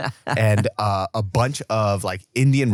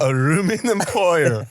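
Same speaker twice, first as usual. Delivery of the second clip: ALUMINUM FOIL slowly as "aroominim poiyer", Korean-accented; 6 semitones higher, 4 dB louder, slower than usual. Shown as a demonstration. Demonstrating the hard phrase, Korean-accented "Aluminum foil". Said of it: "say with a Korean accent".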